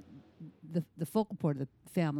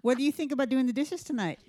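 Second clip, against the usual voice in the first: higher, louder sugary request; sweet polite mom; 8 semitones higher, 4 dB louder than usual. Retold or imitated. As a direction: ask nicely, coaxing the teen. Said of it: "So, Amy".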